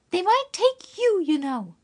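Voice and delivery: put-on high young girl, eager to help; a kind girl reassuring the guest; girl's voice. Dora reassuring the stranger, high and eager. Dora's voice.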